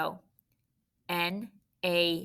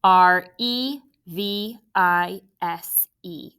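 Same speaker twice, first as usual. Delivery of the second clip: slow spelling out; 7 dB louder, much slower than usual. Shown as a demonstration. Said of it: "Let's spell it together".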